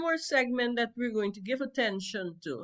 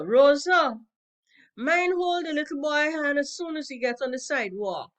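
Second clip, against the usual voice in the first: calling out; 4 semitones higher, 5 dB louder than usual. Acted. Sophia mocking, calling to Rosa.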